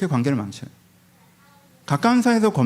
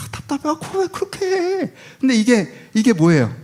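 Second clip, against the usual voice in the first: exasperated complaint; 7 semitones higher than usual. Acted. Acting frustrated spouse.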